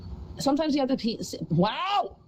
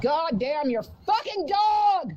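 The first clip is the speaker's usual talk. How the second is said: furious shout; 6 semitones higher, much slower than usual. Real, not acted. Streamer cursing the neighbor's barking dog, slow furious shout. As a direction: drag every word out in rage.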